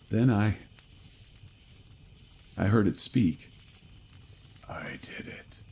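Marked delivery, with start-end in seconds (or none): 4.7-5.6 dry, whispered rasp of a dead thing; papery whispered mutter from a corpse; hoarse creature mutter. Acted. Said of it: "it muttered, hoarsely".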